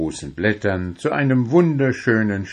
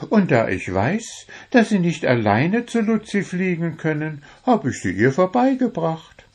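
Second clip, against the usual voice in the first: much higher, pleased with himself; Wuschel, proud; 9 semitones higher than usual. A narrator's reading. Wuschel tells Puh he delivered the love letters, high and proud. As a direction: brag cheerfully about the good deed.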